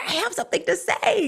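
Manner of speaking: mock over-excited bursting in; high-pitched urgent butting in; excited interjection. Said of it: "We're like".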